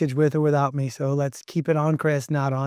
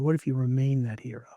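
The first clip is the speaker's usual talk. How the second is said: whisper; 6 dB quieter than usual. Real, not acted. Rhetorical hero question, hushed.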